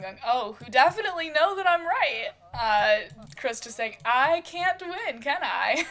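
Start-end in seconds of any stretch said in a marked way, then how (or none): 0.2-2.3 higher, louder teasing lilt; playful giggly tease. Acted. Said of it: "Rosie just kinda giggling along". 4.0-5.9 slow, higher-pitched playful surrender; mock defeat.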